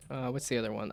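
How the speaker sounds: ordinary talk, nothing marked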